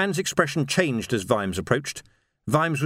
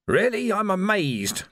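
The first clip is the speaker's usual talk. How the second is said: higher-pitched mild growl; growled sarcasm; 7 semitones higher than usual. A narrator's reading. Vimes to Carrot about his cut, mildly growling. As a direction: growl it in mock amazement. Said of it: "growled Vimes".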